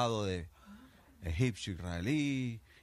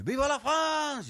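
very high, loud, drawn-out slow cry; patriotic shout; 8 dB louder, much slower than usual. Acted. French soldier shouts for France as he jumps: slow high yell. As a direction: belt it out as you throw yourself out.

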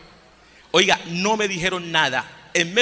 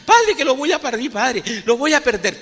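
higher, frantic imitated cry; frightened girl crying to the priest, higher; imitated desperate plea; 7 semitones higher than usual. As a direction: plead in panic for the baby. Girl fears losing baby.

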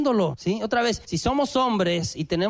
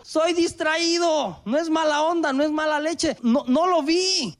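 pleading excuse; 7 semitones higher than usual. Retold or imitated. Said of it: "Y yo".

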